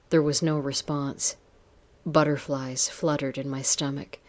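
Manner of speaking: usual speaking voice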